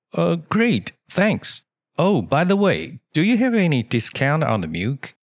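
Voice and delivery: shop customer. Acted. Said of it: "客人又问了".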